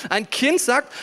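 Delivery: usual speaking voice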